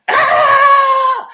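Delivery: high, loud startled shriek; imitated scream. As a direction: shriek like a sudden bang scared her. Retelling the boom from the room.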